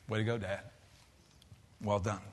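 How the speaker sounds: very soft, low words of tribute to his father; hushed tribute. Real, not acted. A son softly honoring his dead father, hushed. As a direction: let it fall almost to a murmur.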